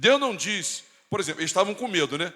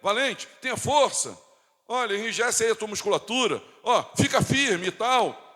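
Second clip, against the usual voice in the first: higher-pitched motivational urging; mock pep talk; 5 semitones higher than usual. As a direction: imitate someone egging on a frightened friend. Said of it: "se Isaías dissesse assim".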